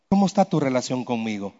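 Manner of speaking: low, soft, searching question; lightly voiced God, hushed; God speaking inwardly. God questioning the preacher on Saturday night, low and soft. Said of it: "Dios me dijo".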